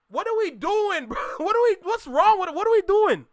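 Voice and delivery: loud, high exasperated yell; high-pitched cry of frustration. Real, not acted. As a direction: shout it in disbelief at your own people. Ranting at fellow Canadians.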